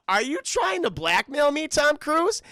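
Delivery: hammed-up fury at being blackmailed; mock furious. The general shouting at Cruise over blackmail, mock fury.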